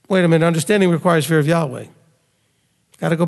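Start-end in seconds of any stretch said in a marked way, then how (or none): none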